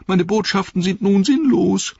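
high, loud whine; whining. Acted. Wormtongue whines to Treebeard in a high, loud voice. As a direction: whine the plea in desperation. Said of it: "winselte er".

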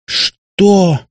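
high, loud indignant gasp; a daughter outraged at her father's betrayal. A narrator's reading. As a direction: choke on the word in outrage. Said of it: "задохнулась от возмущения Катя".

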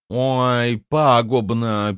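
slow, drawn-out mocking of a clever word; mock imitation. A narrator's reading. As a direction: parrot it back, scoffing. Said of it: "передразнила девушка". (медленно, насмешливо передразнивает)

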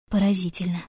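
ordinary delivery

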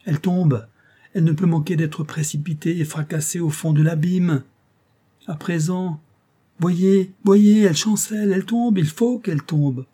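high, loud cries of horror; terrified crowd crying out. Acted. The crowd cries out as Molly nears the edge, high terrified shouting. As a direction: shout in panic as she falls. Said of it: "s'écrie-t-on de toutes parts".

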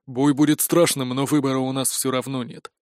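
low, subdued grim remark; gruff muttering. A narrator's reading. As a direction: mutter it grimly before battle. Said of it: "буркнул Хантович". (низко и угрюмо, вполголоса)

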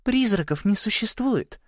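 high, slow, tired dismissal; weary. A narrator's reading. Порша corrects Нэш about ghosts, wearily. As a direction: sigh it off patiently, slowly. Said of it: "устало сказала Порша".

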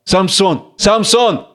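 name called twice, pitch climbing; a touch of alarm, calling for a friend; anxious call. Acted. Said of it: "Вдали тревожный зов Голиала". (тревожный зов, всё выше)

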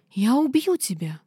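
slow, loud furious scream. A narrator's reading. Heroine screams at Dinar while breaking free, furious. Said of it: "вопила я".

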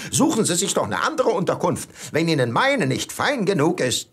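hammed-up shout at the snobbish guest; mock call-out. Hotelier imagining shouting at his guest, mock call-out. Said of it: "am liebsten würde ich ihm zurufen".

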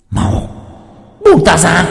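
loud, high demonic snarl; possessed girl. Acted. Possessed girl snarls at Thạnh to let go, loud and high. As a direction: spit it at him like a demon.

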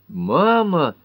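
appalled, pitch leaping up; shocked. A narrator's reading. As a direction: cry out in scandalised protest. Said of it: "Пэт была шокирована".

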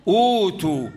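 أوتوا with a long opening oo, no inner stop; substituted long vowel. Shown as a demonstration. Teacher gives the word as read, أوتوا with long oo. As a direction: glide through a long oo in أوتوا. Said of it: "أقوم حيش الهمزة الساكنة وأحط مكانها".

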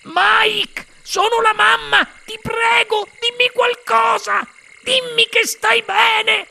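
very high, drawn-out shrill plea; frantic pleading. A narrator's reading. Mother begs her son to answer, frantic. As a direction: beg desperately in a high voice.

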